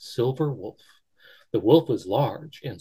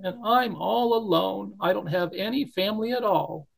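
higher, despairing bunny voice; sad little bunny; 8 semitones higher than usual. Acted. Barrington despairs alone in the snow, high and sad. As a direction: lament being useless.

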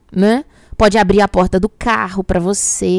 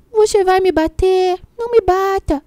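pleading puppy voice; 8 semitones higher than usual. Retold or imitated. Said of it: "fazia aquela carinha".

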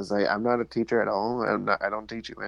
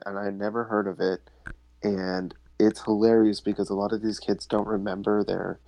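usual speaking voice

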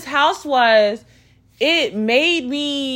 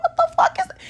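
high-pitched startled question; alarmed reaction; faster than usual. Retold or imitated. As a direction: shoot the voice way up in alarm.

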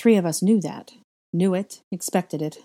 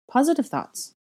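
higher, light reproof; scolding; 8 semitones higher, 3 dB louder than usual. A narrator's reading. Hermione correcting Harry's negative remark, lightly scolding. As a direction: chide him in a lifted pitch. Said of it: "I scolded".

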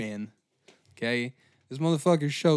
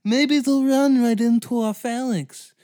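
high, loud cocky voice; smug Greek; 10 semitones higher, 7 dB louder than usual. Retold or imitated. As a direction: brag like it's a sure plan.

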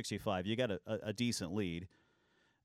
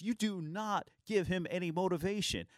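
much higher, indignant scolding voice; angry teammate; 9 semitones higher than usual. Retold or imitated. Teammate to the trash-talker in the locker room, high outraged scolding. Byron Scott chewing out teammate.

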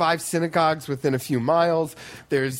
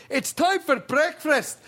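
put-on high, slow Scottish counselor waking the kids; Scottish accent; 10 semitones higher than usual. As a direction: bark it out like a Scottish camp counselor. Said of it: "all of my counselors were Scottish".

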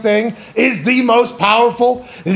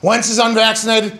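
usual speaking voice

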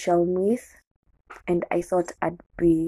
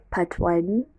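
usual speaking voice

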